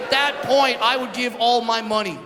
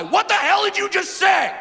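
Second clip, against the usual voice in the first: higher, mock-furious challenge; yelling at the guy; 7 semitones higher than usual. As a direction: shout him out of his car. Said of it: "I start yelling at this guy".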